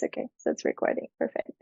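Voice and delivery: soft unvoiced whisper to herself, quickly; whispered aside. Real, not acted. Checking her camera mid-interview, a hushed whispered aside. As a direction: mutter it under your breath while checking the camera.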